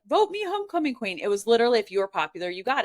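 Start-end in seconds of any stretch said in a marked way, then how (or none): 0.1-1.2 high mock plea for votes; a mock candidate's high-pitched plea; mock campaign plea. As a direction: ham up begging for the crown. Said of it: "you didn't go out and be like".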